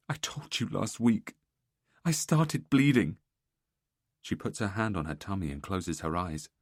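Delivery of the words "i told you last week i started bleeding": slow tearful telling. Acted. Wife explains through tears.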